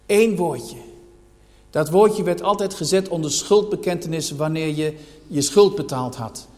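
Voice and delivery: ordinary talk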